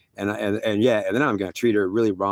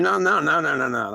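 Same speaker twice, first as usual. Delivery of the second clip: protesting; 6 semitones higher than usual. Retold or imitated. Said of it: "I'm saying".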